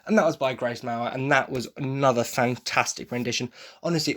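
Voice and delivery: ordinary delivery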